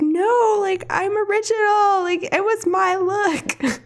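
very high-pitched, hammed-up frustrated wail; super frustrated. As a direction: whine it in mock exasperation, drawn out at the end. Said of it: "super frustrated".

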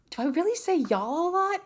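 high, disbelieving question in a put-on voice; incredulous self-quote. Retelling her quiz result, high incredulous question. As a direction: ask it like you can't believe it's true. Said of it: "I'm like".

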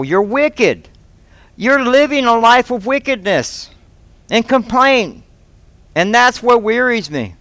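pleading as God, rebuking a faithless people; God speaking. Retold or imitated. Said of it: "he's saying".

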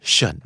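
ชั่น said as a light unstressed เชิญ; reduced shun. Shown as a demonstration. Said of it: "ออกเสียงตามครับทุกคน". (ออกเสียงเบาๆ สั้นๆ ว่า เชิญ)

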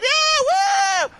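very high shouted mock whoop; mock crowd cheer. Host mimics audience reacting to catchphrase, high cheer. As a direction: cheer like an excited studio audience. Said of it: "everyone's like".

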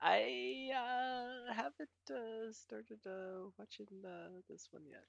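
reluctant admission dwindling almost to nothing; sheepish confession. Real, not acted. Cohost admitting he hasn't watched the show, sheepish.

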